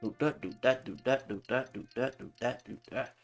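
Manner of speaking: ordinary talk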